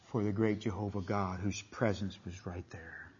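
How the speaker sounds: hushed whisper in awe; reverent whisper. Real, not acted. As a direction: breathe it out as if not to disturb the moment. Awe at God's silent presence.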